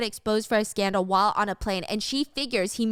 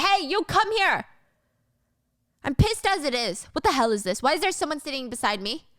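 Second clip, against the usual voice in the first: angry boss; 5 semitones higher than usual. Acted. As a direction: snap at the flight attendant. Wang yelling at attendant.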